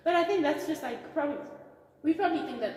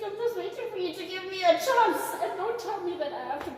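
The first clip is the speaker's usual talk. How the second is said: laughing while talking; 9 semitones higher, 5 dB louder, faster than usual. Real, not acted. Teasing co-host, cutting in.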